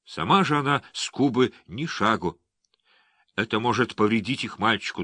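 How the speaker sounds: ordinary delivery, nothing marked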